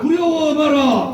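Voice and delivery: slow, high roaring slogan; roaring rallying speech. Real, not acted. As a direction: roar each word to lead the crowd. Leader chants slogan, crowd repeats. (느리고 우렁찬 구호 외침)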